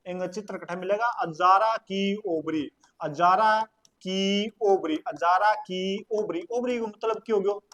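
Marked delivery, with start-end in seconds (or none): 1.1-5.0 अजारा की ovary drawn out slowly, the long आ in Azara stretched; slow dictation. Shown as a demonstration. Teacher to class, repeating the room's name slowly. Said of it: "teacher repeats term slowly". 5.1-6.5 Azara की ovary said slowly and evenly, every syllable clear; slow dictation. Shown as a demonstration. Teacher to class, repeating the name slowly again. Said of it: "teacher repeats term slowly".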